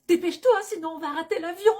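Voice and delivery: loud, high, fast urging; urgent hurrying. Acted. Traveller hurrying a companion to catch the plane, urgent.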